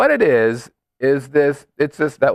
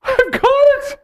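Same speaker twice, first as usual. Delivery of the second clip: excited. Real, not acted. Sudden excited realization.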